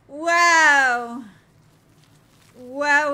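long, loud amazed wow. Real, not acted. Gamer marveling loudly at the view. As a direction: belt out your amazement.

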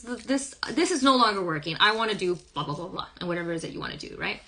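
normal talking voice

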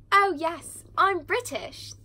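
high, emphatic mock British accent; exaggerated childhood fake British accent. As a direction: put on a loud, high-pitched British accent. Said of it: "started imitating his British accent".

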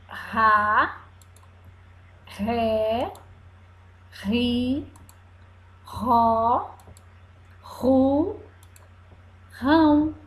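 slow syllables with pauses between them; syllable drill. Shown as a demonstration. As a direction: spell out each syllable for the children. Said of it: "R de quê?".

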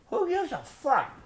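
slow high-pitched squeal; high mock squeal. Real, not acted. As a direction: squeal it out slowly. Rant outburst.

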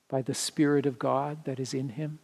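ordinary delivery

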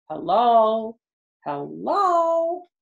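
high, loud sing-song cat hello; cat saying hello. Retold or imitated. To the listening children, imitating her talking cat. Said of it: "she'll say".

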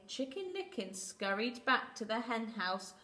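ordinary delivery

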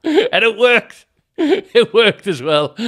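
real delighted laughing; laughing triumph. Real, not acted. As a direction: crow it through laughter.

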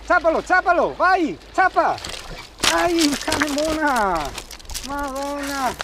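very high, excited cheering; urging the dog, rising with excitement; talking to a dog. Real, not acted. (incitando il cane, eccitata)

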